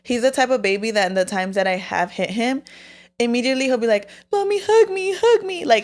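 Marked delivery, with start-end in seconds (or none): 4.3-5.6 put-on child's voice, much higher; little son. Mom voicing her son begging for a hug, child's voice. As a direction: plead like a small boy wanting a hug.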